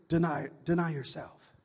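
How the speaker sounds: ordinary talk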